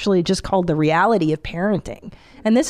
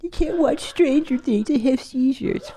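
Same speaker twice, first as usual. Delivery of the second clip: mock whiny complainer; 6 semitones higher than usual. Mocking sensitive viewers' complaint.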